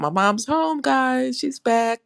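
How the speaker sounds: bright happy announcement, voice lifted high; excited. Real, not acted. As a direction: share the good news with a lift.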